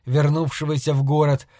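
ordinary delivery

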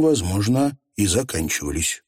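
usual speaking voice

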